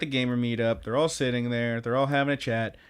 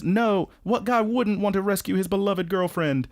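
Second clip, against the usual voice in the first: higher, hammed-up gallant boyfriend; 7 semitones higher than usual. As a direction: declare it like a knight. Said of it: "and he says".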